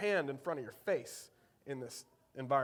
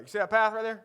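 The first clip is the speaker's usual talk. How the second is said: put-on thick Eastern North Carolina accent; 5 semitones higher, 6 dB louder, faster than usual. Dad's friend points out a path, thick Eastern North Carolina accent. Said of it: "he's got a thick Eastern North Carolina accent".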